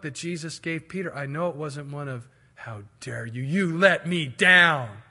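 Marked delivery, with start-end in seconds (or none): 2.5-5.0 hammed-up accusation; mock reproach. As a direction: scold slowly, leaning hard on the last words. Imagined reproachful look of Jesus.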